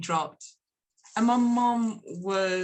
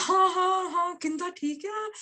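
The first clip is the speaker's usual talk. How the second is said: gushing greeting; 10 semitones higher than usual. Retold or imitated. Mother answering estranged daughter's phone call, gushing. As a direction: greet your child in a delighted, put-on high voice.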